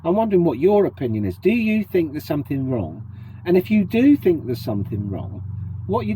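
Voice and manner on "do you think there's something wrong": usual speaking voice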